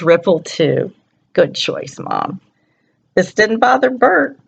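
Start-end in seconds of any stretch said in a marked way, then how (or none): none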